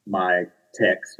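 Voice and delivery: usual speaking voice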